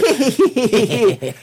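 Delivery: high-pitched imitated creature cackle; creature's laugh. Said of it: "the creature is just like".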